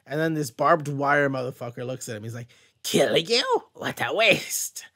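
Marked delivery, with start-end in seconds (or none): 2.8-4.9 lightly put-on sneering villain, slow on the last words; a half-formed creepy villain voice; odd villain voice. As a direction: scoff like a bored monster. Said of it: "I don't know what that voice was".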